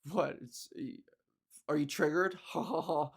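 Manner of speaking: a hint of a low, smug macho gamer with a mock laugh; macho troll. Defensive troll answering her, low smug mock macho voice. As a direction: play the smug guy, low and dismissive, then laugh. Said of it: "in their macho way of responding".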